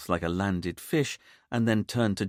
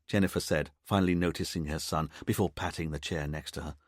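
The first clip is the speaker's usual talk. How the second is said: ordinary delivery, nothing marked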